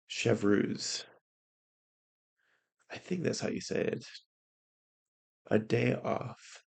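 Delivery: ordinary talk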